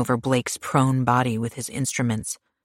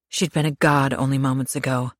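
plain reading voice

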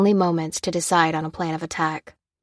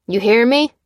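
louder, higher jeering call; shouted taunt; 5 semitones higher, 7 dB louder than usual. A narrator's reading. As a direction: call after her mockingly. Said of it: "cried the leader".

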